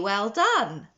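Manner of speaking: ordinary delivery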